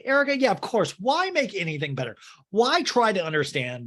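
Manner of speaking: normal talking voice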